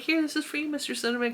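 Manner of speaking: mock presenting a present to her husband; playful gift offer. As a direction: hand it over with a teasing lilt. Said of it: "I was like".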